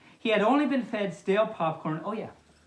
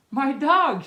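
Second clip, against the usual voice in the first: higher, louder cry of alarm; shout; 7 semitones higher, 7 dB louder than usual. Acted. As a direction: yell for the lost dog. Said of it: "shouted the owner".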